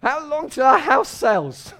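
high, loud wail, slow at the end; a mock-desperate cry about the house sale; anguished cry. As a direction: plead out loud to God. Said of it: "some of us can hear".

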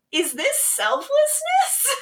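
high, drawn-out mock wonder; mock-naive meme voice, slow and high; butterfly meme Rebecca. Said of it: "me doing the butterfly meme of, like, Rebecca".

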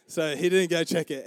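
ordinary delivery

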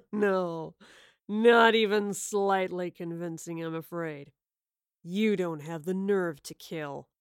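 slow, low mocking villain; taunting villain. A narrator's reading. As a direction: drawl the taunt with scorn.

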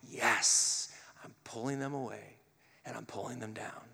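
low, hushed voice of a scheming enemy; quiet sinister tempter; the enemy gloating. Acted. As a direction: gloat softly and darkly, fading away. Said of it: "Or is our enemy going".

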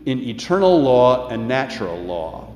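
rising pulpit voice, reading scripture with weight; measured preacher's cadence, lightly heightened; sermon preaching. Acted. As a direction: preach it to the congregation, lifting key lines. Preaches sermon, reads quotations.

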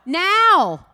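a high, loud single now; preacher shouting now to lead the room; loud call. Shown as a demonstration. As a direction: call it out for the crowd to echo. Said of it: "Everyone say now".

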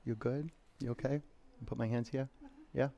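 soft and low, close to student; quiet check-in. Real, not acted. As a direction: gently ask if they're okay. Checking on student during stretch.

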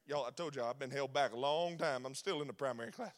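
a put-upon Moses feeling sorry for himself; low, muttered self-pity; sulking Moses. Acted. Moses complains about being held back.

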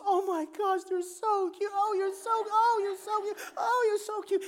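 put-on high, tearful gushing over the kids; crying lady. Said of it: "the lady breaks down and starts to cry".

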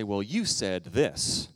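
slow, deliberate quoted wife; lightly voiced wife, drawn out. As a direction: spell out the hurt slowly. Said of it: "And she'll say".

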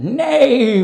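high loud neigh; goose neighing. A narrator's reading. Goose tricking the thieves, high loud neigh. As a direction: neigh with all her might. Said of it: "Then the goose said".

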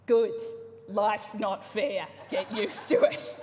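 loud, slow, firm parental retort; stern parent. Retold or imitated. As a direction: shut down the whining child. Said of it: "the very best one".

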